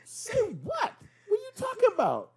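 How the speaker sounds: high-pitched, baffled disbelief; incredulous. Retold or imitated. Smith, stunned, denying the accusation with high disbelief. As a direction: squeak it out in shock. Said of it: "Smith was like".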